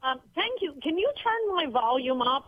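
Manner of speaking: guest on a hotel phone; thin phone-line voice. Real, not acted. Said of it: "I can barely hear you".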